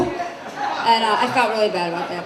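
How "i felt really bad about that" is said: ordinary talk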